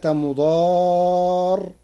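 مضار with a long, fully open aa; مضار with open alif. Shown as a demonstration. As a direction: hold the aa of مضار without tilting it toward e. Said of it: "لا تقليل في ألفها". (مضار بلا تقليل في الألف)

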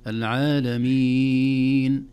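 العالمين chanted loud, final vowel held very long; longest madd. Shown as a demonstration. Teacher showing the six-count option, العالمين held longest. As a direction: stretch العالمين to its fullest, very slowly. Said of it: "اثنين وأربع وست حركات".

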